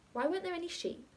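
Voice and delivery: a hint of a curious child; child's question. A narrator's reading. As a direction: ask like an eager little boy. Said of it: "Came the inevitable question".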